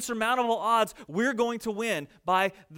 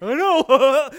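gleeful mock reply; 5 semitones higher, 11 dB louder than usual. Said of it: "It's like".

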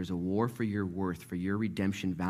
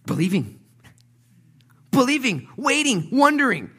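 loud, high, driving sermon delivery; full-force preaching; 8 dB louder than usual. Acted. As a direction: preach it out, building fast.

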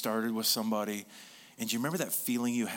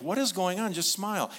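impatient photographer; 8 semitones higher, 3 dB louder than usual. Retold or imitated. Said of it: "you're like".

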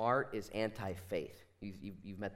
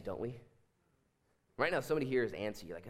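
normal talking voice

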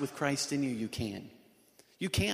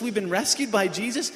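ordinary talk, nothing marked

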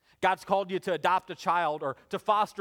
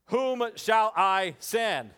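slow, stately proclamation as God; 4 semitones higher, 4 dB louder, much slower than usual. Acted. God calling for a messenger, grand God voice. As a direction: boom it out slowly like God. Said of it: "in your best God voice".

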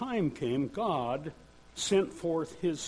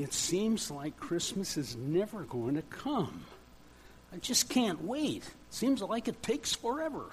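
lightly voiced child, pitch climbing to the end; impatient child; 2 semitones higher, 3 dB quieter than usual. An eight-year-old waiting for Christmas, impatient child voice. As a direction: whine a little like a kid who can't wait. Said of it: "seven, eight-year-olds, maybe some that are in this room that are, that are saying".

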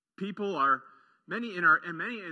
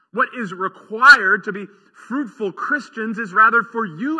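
louder, faster preaching push, words tumbling out; fervent preaching; 3 semitones higher, 8 dB louder than usual. Acted. Pastor urging his church toward thriving, fervent and loud. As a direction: drive the promise home with rising fervor.

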